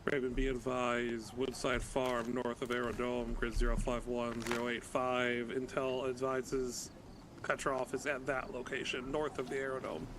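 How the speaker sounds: slow, measured radio report; calm radio call for fire. Real, not acted. Radio report of target location.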